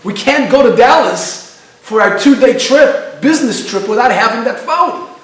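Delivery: a passenger begging his partner, panicking over his phone. Retold or imitated. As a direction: plead in rising panic. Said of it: "And he's panicking".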